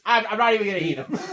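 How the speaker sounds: fast, laughing quote; mock sulky. Cohost quoting the fries order, fast and laughing. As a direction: toss it off while laughing. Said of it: "he's like".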